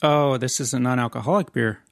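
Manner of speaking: ordinary talk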